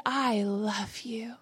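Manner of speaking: slow, hushed and tender; tender declaration. Retold or imitated. Jesus accepts a lonely woman as she is, tender. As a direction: say it slowly, as gentle acceptance.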